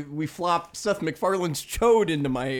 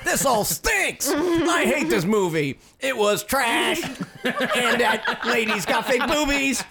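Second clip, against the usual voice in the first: mock cranky critic; 5 semitones higher than usual. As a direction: ham up a cranky movie critic. Mock reporter ranting at movies.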